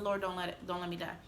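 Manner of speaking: soft, urgent begging; under the breath; quiet pleading prayer. Retold or imitated. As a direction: whisper your prayer. Praying again.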